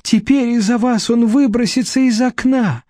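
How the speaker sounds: high-pitched tearful blame; sobbing reproach. A narrator's reading. Vera blaming Nadezhda for her husband, sobbing. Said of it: "С рыданием обратилась к ней Вера".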